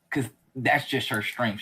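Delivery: ordinary talk, nothing marked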